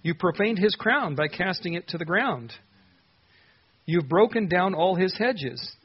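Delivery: anguished accusation. Acted. Psalmist accusing God.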